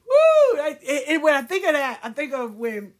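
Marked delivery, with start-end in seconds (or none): 0.1-0.6 very high, loud whoop; joyful whoop. Real, not acted. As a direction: let out a real whoop of joy. Cheering at her own point.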